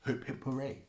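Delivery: mock celebration. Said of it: "everybody's like".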